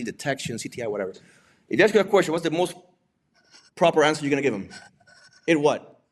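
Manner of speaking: ordinary talk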